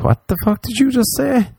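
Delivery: high, stunned disbelief; retold disbelief. Retelling his reaction to the man's threat, high and stunned. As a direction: recoil in shock at what you just heard.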